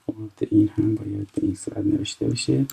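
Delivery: usual speaking voice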